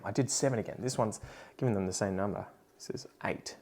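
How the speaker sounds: very soft, under-the-breath aside; muttering to self. Real, not acted. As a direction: mumble the correction quietly to yourself. Muttering while correcting plant number.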